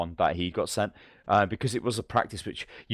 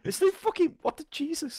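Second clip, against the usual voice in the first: squeaky outburst. Pretend. Exasperated outburst, high voice.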